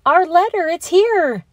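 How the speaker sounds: high, loud excited shout. Acted. Joe shouting to classmates about their letter, high excited shout. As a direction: shout it out, thrilled. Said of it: "shouted Joe".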